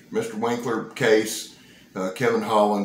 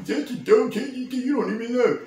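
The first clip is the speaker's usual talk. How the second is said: much higher, nitpicky know-it-all voice; mocking commenter; 13 semitones higher than usual. Mocking a viewer who corrected his review, in a smug high voice. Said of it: "Last time I did one".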